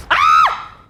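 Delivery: shrill high scream of fright; panicked scream. Real, not acted. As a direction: let out a terrified shriek. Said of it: "I just did a lot of screaming".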